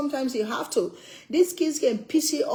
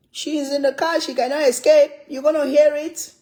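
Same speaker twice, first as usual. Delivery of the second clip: gleeful teasing; 5 semitones higher, 6 dB louder than usual. Acted. Mom teasing daughter trapped in car.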